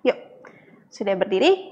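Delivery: normal talking voice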